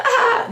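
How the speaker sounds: short "ah" of nervous laughter; imitated nervous laugh. Children laughing after the confrontation, imitated nervous laugh. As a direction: mimic a quick, uneasy laugh. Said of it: "nervous laughter clearly".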